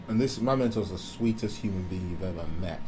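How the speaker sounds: normal talking voice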